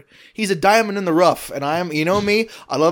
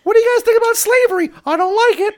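much higher, louder and fast, a squeaky cartoon boy; cartoon kid voice; 4 dB louder, faster than usual. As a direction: chirp it out like a cartoon child. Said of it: "I'm just doing a Mickey Mouse voice".

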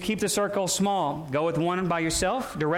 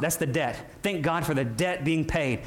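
ordinary talk, nothing marked

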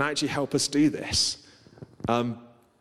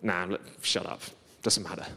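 low, softer impatient brush-off; impatient dismissal; 4 semitones lower, 6 dB quieter than usual. Retold or imitated. Preacher voicing the impatience inside him, low dismissive aside. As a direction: voice an inner impatience, kept low and quiet. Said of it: "there was an impatience in, inside me".

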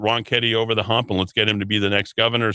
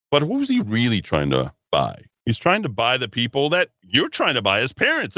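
ordinary talk

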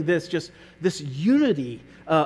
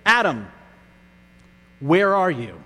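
gentle call; 2 semitones lower than usual. Pretend. God's actual gentle question.

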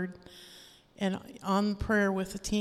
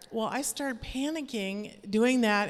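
usual speaking voice

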